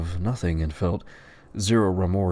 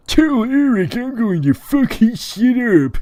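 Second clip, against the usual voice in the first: loud, high, drawn-out threat; hammed-up squealing menace; Tyler; 8 dB louder than usual. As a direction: screech a threat in a squeaky character voice. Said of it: "His name is Tyler".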